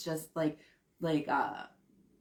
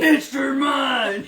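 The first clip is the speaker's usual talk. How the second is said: slow, loud, growled shout; growly dominant voice; 5 semitones higher, 8 dB louder, much slower than usual. Pretend. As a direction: drag it out in a big growl. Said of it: "it'd have to be just like that".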